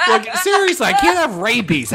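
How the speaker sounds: high-pitched comic protest; mock indignation. Mock complaint about embarrassing diagnosis.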